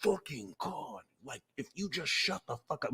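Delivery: soft, weary mock annoyance; muttered exasperation. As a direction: mutter it, done with them. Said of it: "they're like".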